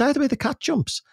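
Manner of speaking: high-pitched baffled repetition; mock incredulous echo. Becca hearing the family saying, imitated incredulous echo. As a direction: echo it back high, as if never heard.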